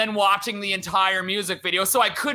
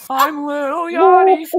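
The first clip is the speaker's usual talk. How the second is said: mock [name]; 6 semitones higher, 6 dB louder than usual.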